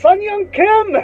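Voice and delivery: high, drawn-out, slow exclamation; mock delighted greeting. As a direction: call out in exaggerated recognition. Surprised greeting of old teacher, retold.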